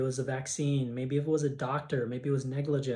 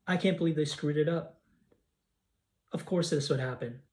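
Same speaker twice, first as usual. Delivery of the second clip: tapping affirmation. Acted.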